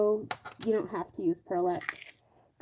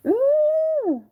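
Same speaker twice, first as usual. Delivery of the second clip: delighted ooh; 6 dB louder than usual. Real, not acted. Admiring shiny paint.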